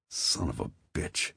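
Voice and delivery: low, soft angry curse; muttered curse. Acted. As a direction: curse quietly to himself. Angry curse under breath.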